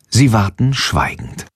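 ordinary delivery

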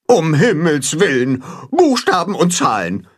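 mild mock goose outrage; scolding goose. A narrator's reading. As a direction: clutch your pearls like a goose. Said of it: "zetert Grimm".

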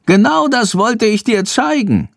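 high squeaky creature voice; bat voice. A narrator's reading. Bat Chandor speaking.

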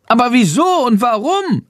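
high, squeaky protesting question; high creature voice, dismayed; dismayed bat. A narrator's reading. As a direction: plead for a reason in a high voice. Horrified bat demands an explanation.

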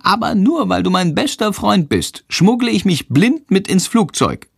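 high bat voice, grudgingly. A narrator's reading. Little bat Sándor grudgingly agreeing for his best friend. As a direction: give in only for friendship's sake.

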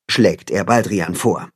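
ordinary delivery, nothing marked

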